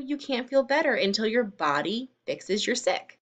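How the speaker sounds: plain reading voice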